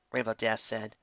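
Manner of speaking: ordinary delivery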